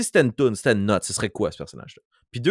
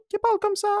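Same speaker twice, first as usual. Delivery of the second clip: comicznie śmieszny głos; faster than usual. Shown as a demonstration. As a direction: pop up into a silly high voice. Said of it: "ça peut être très drôle".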